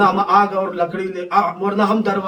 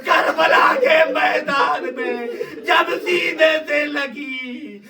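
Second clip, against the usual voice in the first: mourning lament; 4 semitones higher than usual. Real, not acted. Preacher addresses Hussain about his daughter at Karbala, anguished lament.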